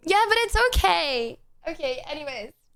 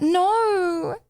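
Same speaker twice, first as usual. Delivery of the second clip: ordinary delivery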